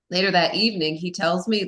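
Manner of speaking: usual speaking voice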